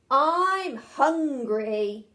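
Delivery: slow, loud, stretched dragon voice; dragon roar. Acted. Hungry dragon roaring at the town from the steeple. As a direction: drag it out like a hungry beast. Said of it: "it roared".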